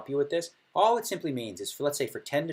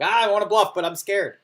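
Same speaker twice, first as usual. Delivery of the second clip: higher and louder, hammed-up nervous; mock scared bluffer; 9 semitones higher, 10 dB louder than usual. Voicing the nervous bluffer's thoughts, hammed-up fear. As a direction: play a jittery bluffer. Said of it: "'cause it's like".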